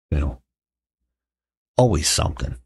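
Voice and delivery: ordinary talk, nothing marked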